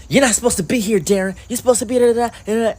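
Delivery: high, nagging mock girl voice; scolding coworker. Other server scolds Darren.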